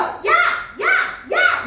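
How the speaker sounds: "야" as one short, high, loud syllable; calling a friend. Shown as a demonstration. Teacher showing how friends are called, "야" shouted as one syllable. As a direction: shout "야" in a single burst. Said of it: "자, 어떻게 불러?".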